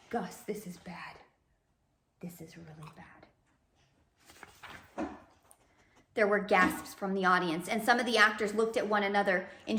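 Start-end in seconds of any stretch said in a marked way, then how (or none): 0.1-5.1 soft unvoiced whisper; whispered aside. Real, not acted. Reader whispering to the dog, soft and worried. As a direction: lean in and whisper the worry. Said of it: "reader whispers aside to dog".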